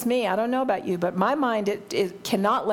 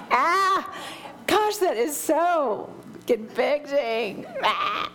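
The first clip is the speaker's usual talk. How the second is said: slow, laughing "gosh", higher pitched; 5 semitones higher, much slower than usual. Real, not acted. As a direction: laugh through it, feeling caught. Laughing after skipping the verse.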